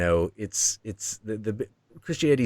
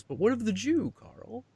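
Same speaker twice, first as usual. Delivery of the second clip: sly devil; 3 dB quieter than usual. Retold or imitated. Devil challenges Jung.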